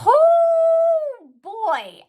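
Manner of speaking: a high, loud exclamation of dismay. Real, not acted. Telling viewers about the medication side effects, a loud high oh boy. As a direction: blurt it out high and loud, half laughing at the ordeal.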